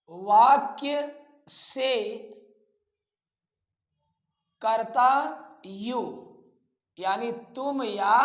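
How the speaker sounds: slow, drawn-out dictation with long pauses. Acted. Teacher dictating a grammar rule to students, slow and spaced out. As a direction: say each phrase slowly so students can write it.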